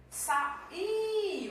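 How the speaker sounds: "Sa, i" split, I held long and high; stressed second syllable. Shown as a demonstration. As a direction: hit the I of "Sa, i" high and loud. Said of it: "Vamos lá". ("Sa, i" separado, com o I tônico agudo e esticado)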